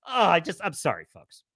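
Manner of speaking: ordinary talk, nothing marked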